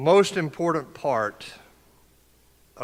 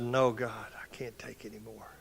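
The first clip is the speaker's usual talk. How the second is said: retold prayer fading to a near-whispered break; retold grief; 3 semitones lower, 7 dB quieter than usual. Speaker relives crying out to God after his sergeant died, in hushed grief. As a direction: let the words drain away in quiet despair. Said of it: "I said".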